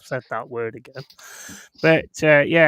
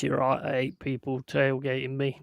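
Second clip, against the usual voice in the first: ordinary talk, nothing marked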